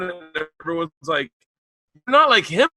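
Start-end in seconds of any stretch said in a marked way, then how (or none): 1.9-2.7 higher, louder mock exclamation; crowd's blurted reaction. Acts out audience blurting at him.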